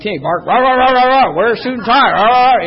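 high, loud rah rah barking; mock barking. Guards yelling at a shy newcomer, loud mock barking. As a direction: imitate a yelling drill instructor. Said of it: "you know, like".

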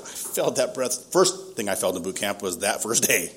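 normal talking voice

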